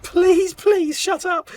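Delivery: high, loud pleading, begging them to stop; desperate plea. Retold or imitated. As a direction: squeal it out in mortified desperation. Embarrassed plea in sweat tent.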